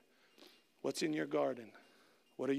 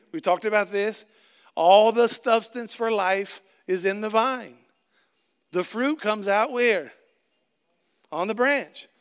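preaching at full force; 3 semitones higher, 8 dB louder than usual. Acted. Pastor driving home the vine and branches, loud preaching. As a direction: drive each line home to the church, full voice.

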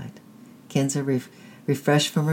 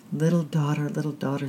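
broken murmur; 3 semitones higher than usual. A narrator's reading. Mother grieving alone at the dead fire, broken murmur. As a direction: murmur it to yourself, grief-stricken. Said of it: "she murmured brokenly to herself".

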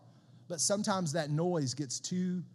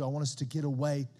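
ordinary talk

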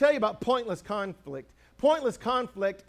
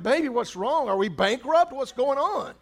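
higher, louder alarmed questions to his wife; retelling his own panic, pitched up and urgent; panicked questions; 5 semitones higher, 4 dB louder than usual. Retold or imitated. Husband finding his crying wife, alarmed and louder. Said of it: "and I'm like".

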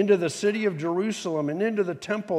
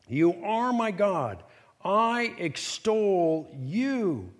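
very slow, stretched-out declaration; confident affirmation; 3 semitones higher, much slower than usual. Acted. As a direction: proclaim it, drawing out every word. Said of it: "of great confidence and affirmation".

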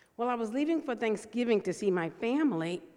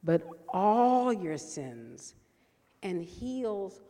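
slow, emphatic psalm reading with long pauses; scripture reading; 2 semitones lower, 5 dB louder, much slower than usual. Acted. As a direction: read the psalm slowly, leaning on each phrase.

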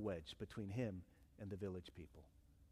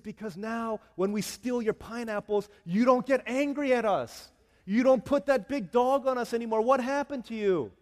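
loud, high, insistent astonishment; acted bewilderment, pushed up high and loud; amazed villagers; 9 semitones higher, 11 dB louder than usual. Villagers amazed at changed missionary.

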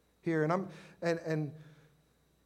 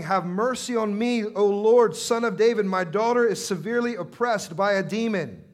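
loud, raised-pitch desperate plea; preacher's forceful cry for help; 5 semitones higher, 11 dB louder than usual. Acted. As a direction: cry out urgently for mercy. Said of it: "came out and was crying".

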